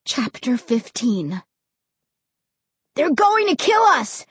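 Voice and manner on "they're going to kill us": put-on high-pitched panicked boy; frightened cry. A narrator's reading. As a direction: cry out in terror. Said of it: "Josh cried".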